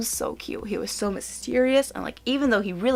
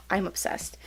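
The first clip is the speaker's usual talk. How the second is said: softer, whispered confession; gushing whisper; 7 dB quieter than usual. Real, not acted. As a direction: whisper it like a guilty pleasure. Showing off her shirt.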